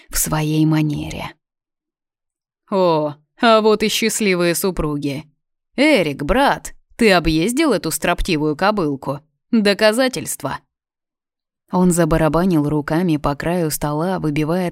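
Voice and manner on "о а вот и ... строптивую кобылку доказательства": loud, boisterous royal welcome; hearty bellowing king; noisy greeting. Acted. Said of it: "шумно поздоровался". (шумное приветствие)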